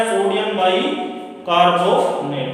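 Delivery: very slow, stretched-out naming; teacher spelling it out slowly; slow dictation. Real, not acted. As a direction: draw the compound name out for the class. Teacher reads out the formula name.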